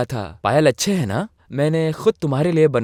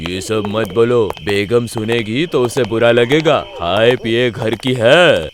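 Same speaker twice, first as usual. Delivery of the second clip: low hushing voice; 7 semitones lower than usual. Acted. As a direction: lower voice, hushing his mate. Second bearer hushes him. (साथी को चुप कराता धीमा नीचा स्वर)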